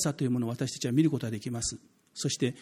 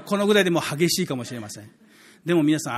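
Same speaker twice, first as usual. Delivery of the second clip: ordinary delivery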